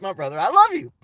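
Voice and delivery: ordinary talk, nothing marked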